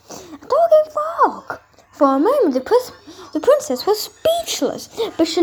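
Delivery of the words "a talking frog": high, slow amazed cry; astonished exclamation. A narrator's reading. Narrator marvelling at a talking frog, amazed. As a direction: gasp it out in wonder.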